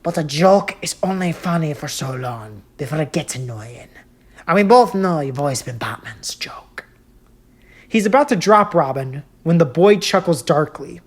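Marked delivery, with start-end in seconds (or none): 0.0-6.9 grinning Joker. Acted. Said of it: "grin, saying".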